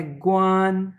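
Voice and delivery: "ग्वान" held high and level; teacher's drawn-out, level "ग्वान"; first tone. Shown as a demonstration. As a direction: sustain "ग्वान" on one high pitch. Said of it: "first tone में".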